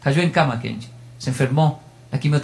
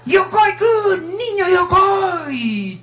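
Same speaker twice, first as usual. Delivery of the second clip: very high, very slow stretched calling; drawn-out joyful call; 14 semitones higher, much slower than usual. Retold or imitated. Old teacher spotting his former student, calling high and slow. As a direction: sing it out across the station. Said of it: "abrió sus ojitos japoneses lo que más se puede".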